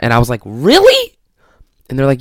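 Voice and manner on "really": much higher, incredulous squeak; shocked disbelief. Retold or imitated. Vlogger retelling hearing he missed sections, shocked.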